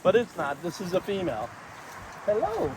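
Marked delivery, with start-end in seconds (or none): 2.2-2.7 pet greeting. Real, not acted. Greeting bird.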